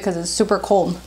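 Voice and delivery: talking between bites; speaking while chewing cake; mouth full, chewing. Real, not acted. Seen 0: Takes bites of snack cake and chews while speaking throughout.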